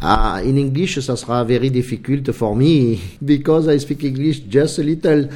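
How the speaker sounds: halting, amused English with a Marseille accent. Real, not acted. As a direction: speak English like a man from Marseille. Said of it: "Avec l'accent marseillais".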